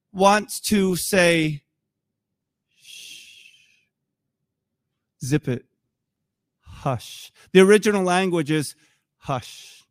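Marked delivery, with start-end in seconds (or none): 2.8-3.5 soft, drawn-out "shh"; long shush. Acted. Jesus silencing people who are fighting, soft shush. 5.3-7.3 low, soft and spaced out; hushing command. Acted. Jesus silencing people who are fighting, low hush. 9.2-9.8 one low, hushed word; soft hush. Shown as a demonstration. Preacher giving the original word, softly hushed.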